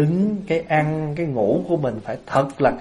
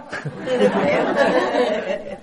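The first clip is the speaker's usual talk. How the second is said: high-pitched giggling laughter; real laugh; 8 semitones higher than usual. Real, not acted. Speaker amused by his own tease, real giggly laugh. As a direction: laugh freely at the joke.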